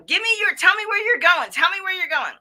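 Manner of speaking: loud, high-pitched repeated demand; rude yelling officer. Acted. Coach playing an officer demanding answers, rude yell. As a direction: bark it like an aggressive cop. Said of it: "they're gonna yell and they're gonna be rude".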